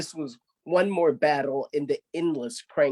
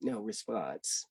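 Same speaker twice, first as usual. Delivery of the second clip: dry deadpan refrain; 5 semitones lower, 11 dB quieter than usual. A narrator's reading. Narrator's flat repeated refrain.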